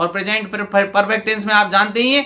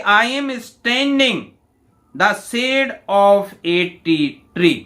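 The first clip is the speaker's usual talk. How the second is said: very slow, each word set apart for students; slow dictation reading; much slower than usual. Shown as a demonstration. Teacher reads the question to students, slow and deliberate. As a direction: read it out like dictation to the class.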